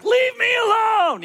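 high shouted plea, drawn out slowly; yelling. Retold or imitated. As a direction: yell it up at Jesus, slow and high. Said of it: "you're like".

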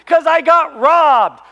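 slow, high, shouted grievance; retold indignant yell; mad kid. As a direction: shout it drawn out, like a wronged kid. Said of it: "And I'm so mad".

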